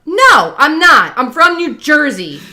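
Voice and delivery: loud, high indignant correction; fired up. Retold or imitated. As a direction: snap back, indignant, saying "Jersey" with a clear er. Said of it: "It's 'cause they're fired up".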